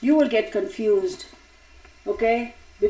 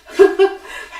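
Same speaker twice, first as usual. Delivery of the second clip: bright laugh with a burst of breath; real laugh; 10 semitones higher, 6 dB louder than usual. Real, not acted. Host laughing at her teasing of viewers, real laugh. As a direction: laugh out at your own joke.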